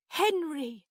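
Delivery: high, loud, startled cry of a name; fearful, high-pitched exclamation; frightened. A narrator's reading. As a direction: cry out to a ghost in alarm. Frightened aunt addresses the ghost.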